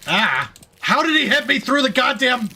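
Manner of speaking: high, loud angry yell; frustrated shout. Real, not acted. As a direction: shout it out, outraged. Shot by enemy, outburst.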